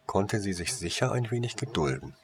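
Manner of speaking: ordinary delivery, nothing marked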